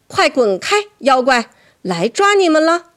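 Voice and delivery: 拔高嗓门、响亮地赶走妖怪; 毫不畏惧地冲妖怪大声喊. A narrator's reading. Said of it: "我大喊道". (勇敢地大喊)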